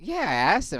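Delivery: high mocking coworker voice. Coworker admits asking, high mocking voice. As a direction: squeak it like the coworker.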